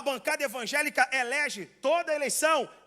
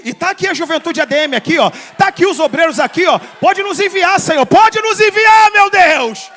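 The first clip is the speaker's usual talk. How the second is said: shouted plea to God, rising higher and louder; full-force preaching; 4 semitones higher, 15 dB louder than usual. Real, not acted. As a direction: cry out to heaven at full volume.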